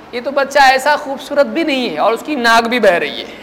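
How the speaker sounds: ordinary talk, nothing marked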